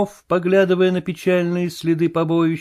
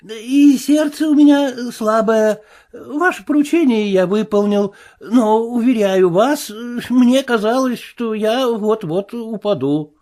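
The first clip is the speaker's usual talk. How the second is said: frightened tramp; 8 semitones higher, 3 dB louder than usual. Acted. Marvel excusing himself to the invisible man, higher whine. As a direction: beg like a bruised coward.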